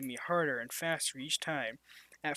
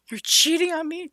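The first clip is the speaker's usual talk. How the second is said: high, loud shocked accusation; shocked outburst; 12 semitones higher, 10 dB louder than usual. Acted. Karen bursting in on her cheating husband, shocked shriek. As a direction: catch him in the act, shrieking.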